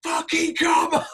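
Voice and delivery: high, slow hammed-up shout into the mic; retold mic shout. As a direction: roar the crowd on, replaying it. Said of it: "I'm like".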